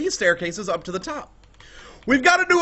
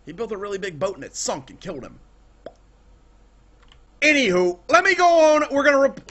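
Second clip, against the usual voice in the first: ordinary delivery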